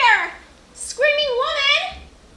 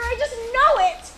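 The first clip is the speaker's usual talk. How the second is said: ordinary talk, nothing marked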